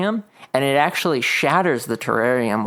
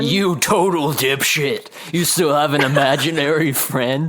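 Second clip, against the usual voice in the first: mocking monster voice. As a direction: taunt the hero with contempt.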